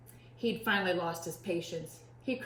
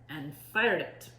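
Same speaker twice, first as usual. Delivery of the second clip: plain reading voice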